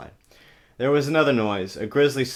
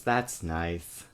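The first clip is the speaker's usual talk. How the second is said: ordinary talk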